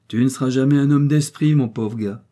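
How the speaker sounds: loud opening, dropping on the last words; pitying. A narrator's reading. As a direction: talk down with mild pity. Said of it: "dit-il d'un ton de pitié". (d'un ton de pitié condescendante)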